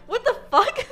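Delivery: laughing shock. Real, not acted. Reacting to dance moves.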